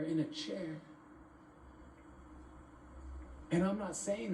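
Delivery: emphatic rhyming recital, starting high and settling; spoken-word poem. Acted. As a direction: drive the rhymes home, pausing between verses.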